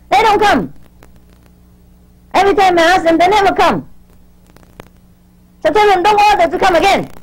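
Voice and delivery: real exasperation, each sentence dropping firmly at the end; exasperated scolding. Real, not acted. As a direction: wash your hands of them, indignantly. Fed up with disciples who never come.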